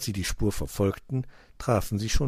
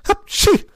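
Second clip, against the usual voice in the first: very high, loud "hapschi" sneeze; acted sneeze; 12 dB louder than usual. A narrator's reading. Cat smelling fox, explosive sneeze. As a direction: burst into a storybook sneeze. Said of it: "nieste er".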